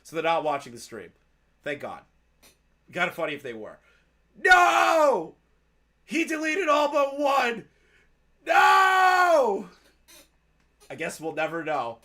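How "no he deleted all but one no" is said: high shouted wails of despair; hammed-up anguish. Streamer mock-wails that the messages are gone. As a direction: cry out in mock grief.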